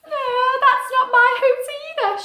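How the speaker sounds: high, loud let-down owlet; not that one either; disappointed little owl. Acted. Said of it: "she thought".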